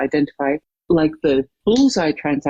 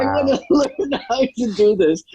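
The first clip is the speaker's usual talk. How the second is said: laughing; 6 semitones higher than usual. Real, not acted. Laughing while telling story.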